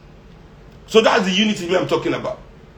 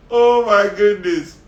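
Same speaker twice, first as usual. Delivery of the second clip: ordinary talk, nothing marked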